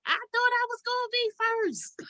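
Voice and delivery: high, soft mock mother voice; playful impression of his mother; imitating mom. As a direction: mimic mom whining she'll be first. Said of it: "my mother be talking about she gonna be first".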